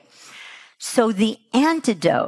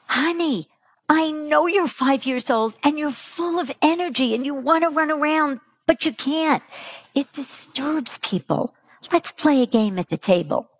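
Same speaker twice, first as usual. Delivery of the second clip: put-on higher, gentle voice to a small child; patient parent; 8 semitones higher than usual. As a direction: coax a restless child kindly. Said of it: "do we say to them".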